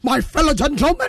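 imitating an American-style Akata accent; lightly put-on Akata accent; mock Akata accent. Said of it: "Ɔno kita Akata accent".

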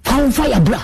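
high-pitched, heated dare; fired-up challenge. Real, not acted. Challenging rivals to bring fire.

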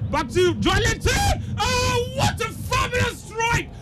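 ecstatic high-pitched shouted goal commentary; goal call. Real, not acted. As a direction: cry out the goal in soaring, sustained excitement. Commentator calls a goal live.

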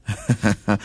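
short low chuckle; real, brief laugh after reading out a message. Real, not acted. Reacts to listener's message.

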